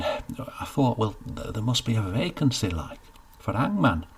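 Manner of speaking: put-on young northern farmhand angling for a job; eager rustic Cedric. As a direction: push your case, keen and hopeful. Cedric pleads for the post.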